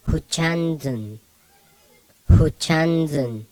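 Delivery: Huchanzun slowly, last vowel a blurred U; mixed vowel. Shown as a demonstration. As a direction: draw out Huchanzun with a muffled vowel in zun. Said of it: "it's pronounced like this".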